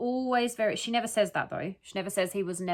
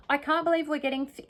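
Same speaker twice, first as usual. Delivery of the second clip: ordinary talk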